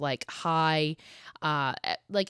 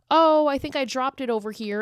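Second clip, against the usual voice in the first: higher, louder sweet girl voice, lightly put on; innocent little girl; 8 semitones higher, 5 dB louder than usual. Young girl innocently lying to her mother, in a lightly put-on sweet high voice. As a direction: play innocent to cover the hidden fork.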